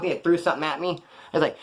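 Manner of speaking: ordinary talk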